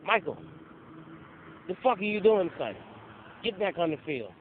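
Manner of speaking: mock head coach yelling at his player; angry coach shout. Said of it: "he's like".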